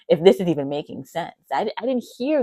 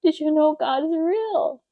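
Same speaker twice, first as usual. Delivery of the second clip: awed question; 10 semitones higher, 4 dB louder than usual. Retold or imitated. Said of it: "I was like".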